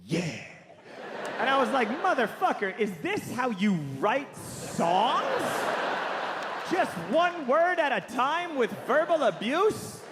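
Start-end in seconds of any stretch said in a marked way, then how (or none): 0.0-0.2 abrupt one-word rock-star approval; curt, put-on star's yes. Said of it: "And [name] said". 2.0-10.0 higher, incredulous mock outburst, stretching the question; hammed-up indignant questioning; exasperated.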